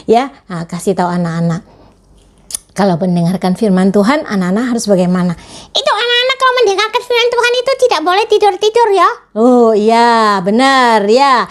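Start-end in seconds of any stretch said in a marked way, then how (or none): 5.7-9.1 much higher, fast chattering puppet voice; excited puppet. Acted. Puppet lecturing classmates about pandemic rules, high and fast. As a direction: rattle off the rules as an eager puppet.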